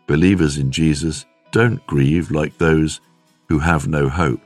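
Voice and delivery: ordinary delivery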